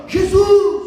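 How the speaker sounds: high, very loud cry to Jesus; shouted call. Acted. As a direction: yell the name to be heard over a crowd. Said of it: "gritou mais alto ainda".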